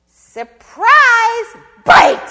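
sudden high, very loud shout; spring it on them at full volume; gleeful attack. Acted. Snake in the pocket striking possum, explosive shout.

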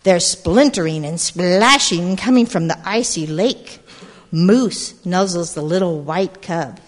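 put-on lively read-aloud to a group of kids; storybook narration. A narrator's reading. Storyteller reading to gathered children, lively narration. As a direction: tell it like a picture book at storytime. Reads picture book aloud.

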